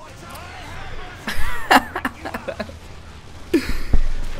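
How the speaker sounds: helpless high-pitched laughter; high laughing fit. Real, not acted. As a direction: crack up at your own joke. Reliving own jokes, laughing.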